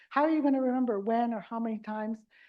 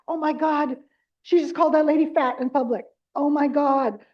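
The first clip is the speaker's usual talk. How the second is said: louder, mock horrified gasp; mortified caregiver; 3 semitones higher, 7 dB louder than usual. Caregiver aghast as mom insults a stranger, louder mock horror. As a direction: act out shock at what mom just said.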